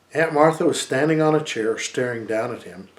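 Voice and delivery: ordinary delivery, nothing marked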